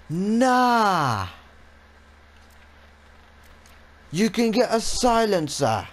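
high, loud gasp of delight; thrilled at the find; excited. Real, not acted. Gasps at new upgrade.

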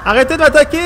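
loud, high cry to stop; frantic plea; panicked shout. Real, not acted. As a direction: yell at attackers in fright. Pleading monsters stop attacking.

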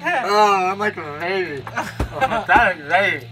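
normal talking voice

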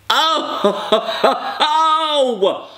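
squealing high laugh. Real, not acted. Pouring syrup, giddy high laugh.

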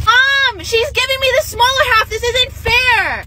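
put-on tattle to mom like a wronged kid; whiny tattletale. Younger sister complains to mom.

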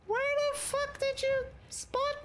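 very high incredulous squeal; high-pitched indignation. Real, not acted. Player spotted by an enemy, high indignant squeal. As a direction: let the voice leap up in disbelief.

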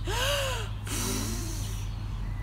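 high strained wolf puff; big puff. Acted. Wolf puffing.

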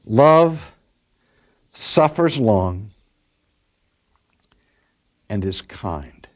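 slow, weighty reading of the verse; scripture reading. Acted. Preacher reading the verse to the congregation, slow and weighty. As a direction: dwell on each word of the verse.

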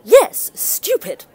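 scornful. Acted. Mother's daughter repeating the insult to the storyteller, scornfully. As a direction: lean hard on the yes, contemptuous.

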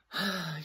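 audible giving-in sigh; reluctant sigh. Acted. Unicorn gives in to friends, sighing. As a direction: sigh as you give in. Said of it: "Unicorn sighed".

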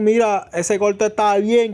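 ordinary talk

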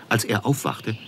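ordinary delivery, nothing marked

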